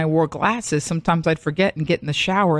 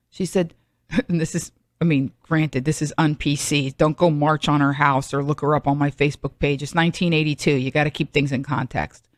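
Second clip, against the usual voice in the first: usual speaking voice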